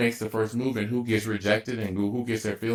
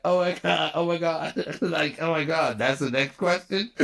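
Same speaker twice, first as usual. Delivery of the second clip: high, laughing oh my God; laughing disbelief; 7 semitones higher than usual. Retold or imitated. Son reacting to his mother's question, laughing high. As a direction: gasp it out between giggles. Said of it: "And I bust out laughing".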